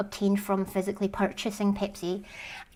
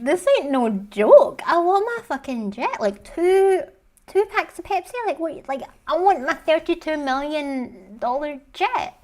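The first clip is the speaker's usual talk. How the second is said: high, indignant mock rant; indignant outrage; 7 semitones higher, 5 dB louder than usual. John to Pepsi over the jet, indignant. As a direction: demand what you're owed.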